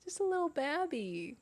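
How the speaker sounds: high coo sliding down. Real, not acted. Doting on the little kitten, baby-talk coo.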